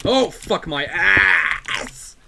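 loud, drawn-out shout of alarm; startled shout. Real, not acted. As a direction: yell it out, slow and loud, caught off guard. Sudden scare in the game.

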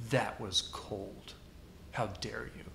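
low, slow, soft stern rebuke; cold offended father. Retold or imitated. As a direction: chill the room like an insulted dad. Offended father scolding photographer.

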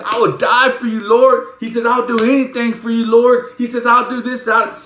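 high, loud, eager vows; Peter. Retold or imitated. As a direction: pile up fervent promises, imitating an overzealous disciple.

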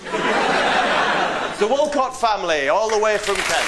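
slow booming game show introduction; announcer voice. Acted. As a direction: stretch the name out like a host. Game show host introducing family.

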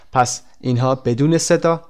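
usual speaking voice

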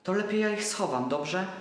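put-on a boy making a brave plan; hopeful childlike question; eager little boy. Boy decides to hide toys.

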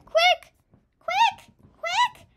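high, very loud single bursts; urgent yelling. Real, not acted. Child gamer racing the timer, urgent yelling. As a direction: yell each word as its own burst.

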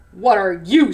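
lightly voiced low lion; menacing lion. A narrator's reading. Lion towering over chameleon, lightly menacing, low.

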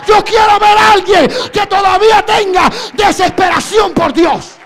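loud, driving sermon shout; full-force preaching. Acted. Preacher challenging the church about lost hunger for God, full-force preaching. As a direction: challenge them at the top of your voice.

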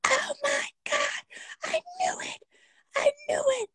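very high, shrieking wail; mock the wife catching him on camera; wife catching cheater on camera, shrieking; caught-out shriek. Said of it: "And then it's like".

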